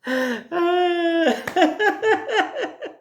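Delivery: high laugh to tears; crying with laughter. Shown as a demonstration. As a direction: laugh till you cry. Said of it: "simplement riez". (rire aux larmes)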